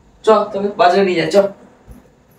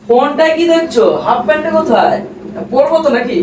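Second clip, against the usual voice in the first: higher, exasperated questioning; annoyed demand; 6 semitones higher than usual. Acted. Man nagging partner on the phone for his shorts, exasperated. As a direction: demand an answer from someone glued to the phone.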